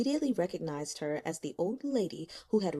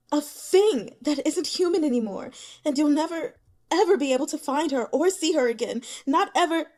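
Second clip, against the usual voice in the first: higher, louder, pressing and relentless; menacing character voice; 8 semitones higher, 7 dB louder than usual. Acted. Speaker taunting Li Qian about her lost grandmother, high and loud. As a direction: paint death vividly to frighten her, higher and loud.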